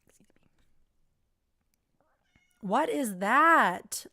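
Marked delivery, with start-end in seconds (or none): 2.6-4.1 shocked. Real, not acted. Shocked at strange image.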